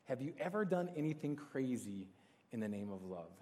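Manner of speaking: hushed and falling away at the end; reflective repeat. Real, not acted. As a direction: let the question sink in quietly. Repeats the question to ponder.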